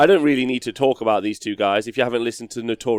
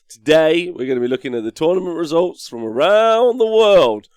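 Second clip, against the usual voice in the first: loud, higher hyped host intro; presenter voice; 5 semitones higher, 5 dB louder than usual. Acted. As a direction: sell the show like an announcer.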